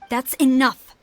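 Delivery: loud, cutting rebuke; angry outburst. Acted. Commander silencing her officer's excuses, loud and angry. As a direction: shut down the excuses hard.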